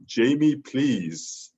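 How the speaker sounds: high, loud cry on the name; mock action-hero plea; Arnold Schwarzenegger impression. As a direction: plead like a movie soundboard clip, punching the name. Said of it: "where he would go".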